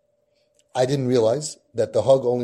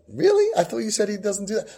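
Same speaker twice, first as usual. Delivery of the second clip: retold surprise; 7 semitones higher than usual. Reacting to kid's news.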